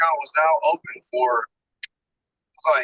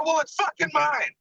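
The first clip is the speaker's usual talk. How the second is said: retold angry rant; 11 semitones higher, slower than usual. Retold yelling at bank rep.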